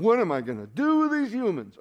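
mock a worried, helpless god; a hint of panicked whining; fretful whine. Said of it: "wringing his hands going".

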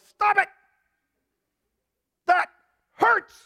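high, loud comic yelp of protest; hammed-up high-pitched outcry; pained mock shout. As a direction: yell as wheat being beaten in the sifter. Wheat protests being sifted.

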